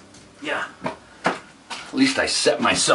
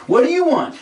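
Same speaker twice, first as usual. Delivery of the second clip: high, loud sing-song question; high pet voice; 5 dB louder than usual. Acted. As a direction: squeak it at the pet. Talks to pet.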